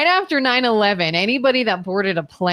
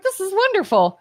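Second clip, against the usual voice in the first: delighted; 10 semitones higher than usual. Retold or imitated. Delighted inner reaction, retold.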